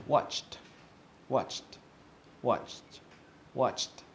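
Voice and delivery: "watched" as one syllable ending in a T sound. Shown as a demonstration. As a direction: demonstrate "watched" for repeating, final T. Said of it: "sound a little bit like a T".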